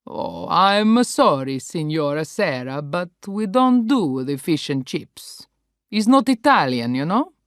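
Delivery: put-on apologetic Italian accent; sorry but firm, Italian style; Italian host. Paolo gently refusing fish and chips, Italian accent.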